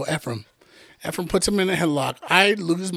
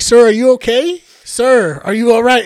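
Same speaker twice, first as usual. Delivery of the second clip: raised, higher concerned call; mock concerned call; 5 semitones higher, 8 dB louder than usual. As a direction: call out to rouse him, worried. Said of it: "I was like".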